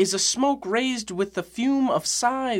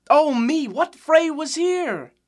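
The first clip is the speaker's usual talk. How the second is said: dismayed; 6 semitones higher, 4 dB louder, slower than usual. Acted. Romeo sees signs of street brawl.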